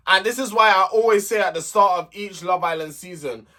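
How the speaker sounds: ordinary delivery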